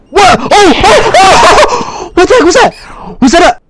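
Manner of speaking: high-pitched gasping alarm; startled panic. Real, not acted. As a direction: cry out as if jumped by a ghost. Startled by a possible ghost rat.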